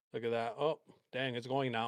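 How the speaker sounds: ordinary talk, nothing marked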